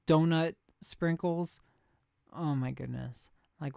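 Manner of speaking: ordinary talk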